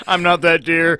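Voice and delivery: laughing joke line, loud and high. Pretend. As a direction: giggle through the line. Joking deer voice, laughing.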